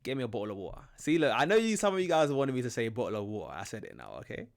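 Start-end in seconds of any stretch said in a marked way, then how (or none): 0.0-0.8 relaxed British "bottle of water" with glottal stops for the Ts; casual, T-less "bottle" and "water"; dropped Ts. Shown as a demonstration. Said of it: "I don't pronounce the T". 2.9-3.5 "bottle of water" with the Ts swallowed; relaxed glottal stops in "bottle" and "water"; dropped Ts. Shown as a demonstration. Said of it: "I don't pronounce the T".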